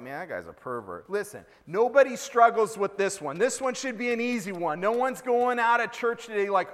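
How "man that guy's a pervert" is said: low, soft acted mutter; quiet, low-pitched disapproving aside. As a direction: say it under your breath, as if walking away. Aside while walking away from creep.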